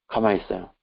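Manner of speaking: ordinary talk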